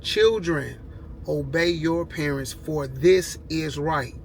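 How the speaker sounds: slow, deliberate Bible quote; Children, obey your parents, stretched out word by word; said slowly. Shown as a demonstration. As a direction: spell it out slowly so it sinks in. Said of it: "I'm gonna say it slow for you".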